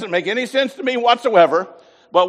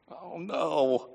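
usual speaking voice

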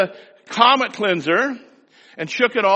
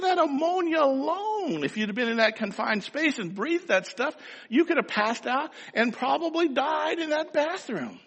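a hint of a high, softer worried mother; mother warning son about fumes, softly worried; upset mother; 8 semitones higher, 5 dB quieter than usual. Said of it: "my mother just got all upset".

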